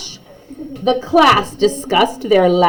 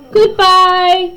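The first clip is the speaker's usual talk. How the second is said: a light call after them, higher; calling goodbye; 10 semitones higher than usual. Acted. Teacher calling after her students, light call. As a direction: call after the leaving kids. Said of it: "Missus Hartwell called after them".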